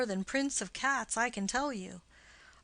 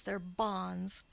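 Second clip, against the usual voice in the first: ordinary delivery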